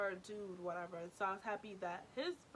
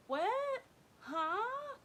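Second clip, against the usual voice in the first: high, loud mock bafflement; playing innocent; 14 semitones higher, 7 dB louder than usual. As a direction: squeak it like feigned cluelessness.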